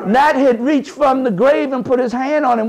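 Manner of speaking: usual speaking voice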